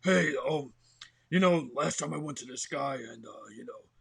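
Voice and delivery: character voice demo from down deep. Shown as a demonstration. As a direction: place the voice deep and project it. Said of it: "you're deeper over here".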